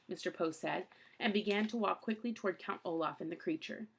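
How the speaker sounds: ordinary delivery, nothing marked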